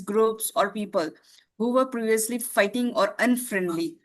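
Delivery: ordinary talk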